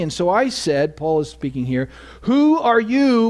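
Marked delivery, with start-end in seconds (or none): 2.2-3.3 crying out. Acted. Paul crying out to the voice.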